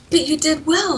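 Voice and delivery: high, gentle consoling voice; imitating Ryan consoling. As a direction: pat her on the head with it. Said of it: "And then he'll go".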